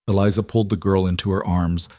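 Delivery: plain reading voice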